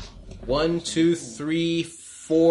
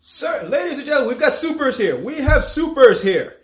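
high, fast, rising alarm, much louder by the end; panicked soldier alarm; 11 semitones higher, 6 dB louder than usual. Acted. Soldier shouting a warning to his officers, panicked and loud. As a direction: warn everyone that supers are here.

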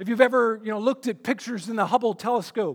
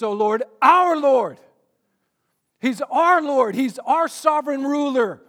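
louder, emphatic preaching of the refrain, slower on the refrain itself; fervent preaching; 4 semitones higher, 5 dB louder than usual. Acted. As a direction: proclaim it with rising fervour, leaning on each "our". Preaching the refrain at full force.

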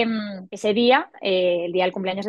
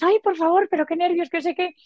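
much higher, gushing coworkers' excitement; excited gushing; 8 semitones higher than usual. Retold or imitated. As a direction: squeal it like thrilled colleagues. Técnicos emocionados al verlos llegar. (compañeras emocionadas, voz mucho más aguda)

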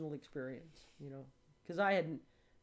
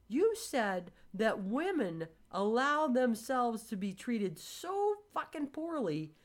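pointed lecture; 8 semitones higher, 3 dB louder than usual. Retold or imitated. Explaining to her friend what he said, pointed. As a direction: spell it out to him, word by word.

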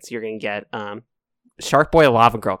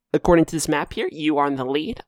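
ordinary talk

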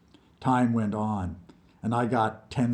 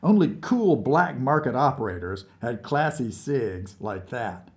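ordinary delivery, nothing marked

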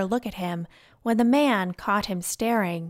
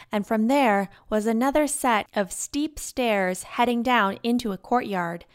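ordinary delivery

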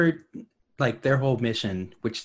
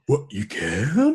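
mock amazed gasp; 5 semitones higher, 3 dB louder, much slower than usual. Acting amazed cadet.

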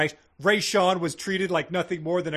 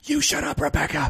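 shouting; 2 semitones higher, 7 dB louder than usual. Acted. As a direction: yell back at her. Acted argument.

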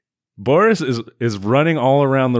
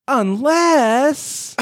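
high coy tease; 4 dB louder than usual. Retold or imitated. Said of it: "then says".

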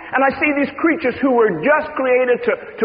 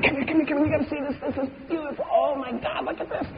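hushed wonder; 6 semitones higher, 8 dB quieter, faster than usual. Acted. Excited friend at kaleidoscope.

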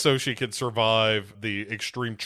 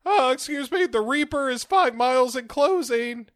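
put-on a much higher, boyish voice; high squeaky boy voice; 11 semitones higher than usual. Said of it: "and he's like".

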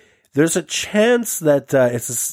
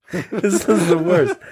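higher, slow, through laughter; laughing dismay; 5 semitones higher, slower than usual. Real, not acted. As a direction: groan it out while laughing. Host laughs at bad guesses.

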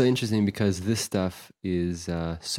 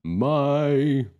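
boastful Morpheus; 4 semitones higher, 3 dB louder, slower than usual. Pretend.

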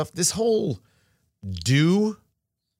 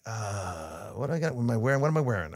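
much softer, anxious muttering to yourself; worried inner voice; 3 semitones lower, 6 dB quieter than usual. Retold or imitated. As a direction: mutter your checklist nervously under your breath. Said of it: "I'm like".

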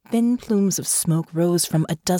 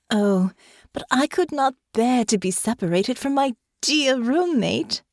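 high, gushing mock-sweet protest; cried out; 6 semitones higher than usual. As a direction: exclaim with sugary false affection. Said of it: "she cried".

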